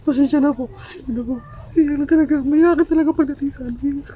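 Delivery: sobbing, high breaking voice; fast tearful outburst, choked up; crying. Real, not acted. Breaks down, apologizes while crying.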